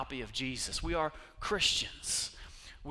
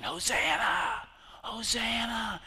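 crowd shouting; 8 semitones higher, slower than usual. Retold or imitated. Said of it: "People started shouting".

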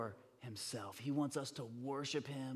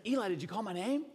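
higher, louder urgent question; eager young Samuel; 7 semitones higher, 6 dB louder, faster than usual. Retold or imitated. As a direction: act a boy running in to ask. Boy runs to Eli asking.

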